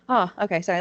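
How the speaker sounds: ordinary talk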